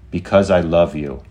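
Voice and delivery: lightly voiced AI, stated firmly; AI confessing love.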